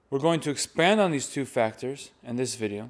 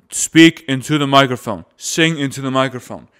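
much louder, projecting; projected voice; 10 dB louder than usual. Shown as a demonstration. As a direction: push the voice out to the back pews. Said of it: "projecting demo".

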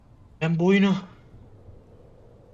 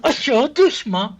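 "Aşağı düşme" yelled slowly, higher and louder; desperate slow shout; 6 semitones higher, 7 dB louder, much slower than usual. Real, not acted. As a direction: shout the plea as the character slips. Pleading with falling character. ("Aşağı düşme" yavaş, yüksek ve bağırarak yalvarma)

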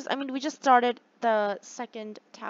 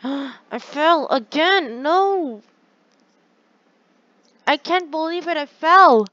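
loud, high wail of real frustration at falling; frustrated yell; 6 semitones higher, 13 dB louder, slower than usual. Real, not acted. Player falling off the tower in the game, frustrated yell. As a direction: cry out in dismay, pitch climbing as you yell.

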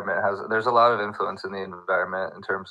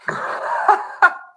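high unvoiced burst of laughter; 7 dB louder than usual. Real, not acted. Laughing hard at his friend's New York joke, a high laugh. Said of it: "That's really good".

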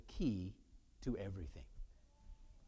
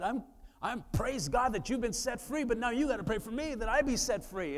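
higher, louder, overjoyed praise; excited exclaim; 8 semitones higher, 6 dB louder than usual. Retold or imitated. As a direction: burst out with joyful preaching energy. Retelling excited reply to churchgoer.

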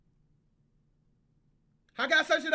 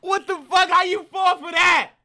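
loud laughing outrage, pitched up; disbelieving shout; 5 semitones higher, 10 dB louder, slower than usual. Real, not acted. Streamer yelling at the kid who fell for the prank, loud disbelief. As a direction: yell at the screen in disbelief.

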